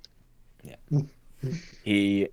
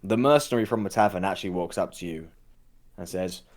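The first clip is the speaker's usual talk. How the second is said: ordinary talk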